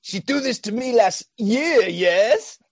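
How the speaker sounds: imitating Keanu's French hockey player accent. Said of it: "he says in his amazing accent".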